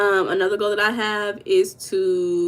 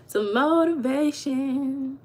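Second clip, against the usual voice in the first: stretched slow and a little higher; slow, drawn-out emphasis; 5 semitones higher, much slower than usual. Shown as a demonstration. Vlogger teasing a chat viewer, slow and drawn-out. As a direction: drag out Some motivation for effect.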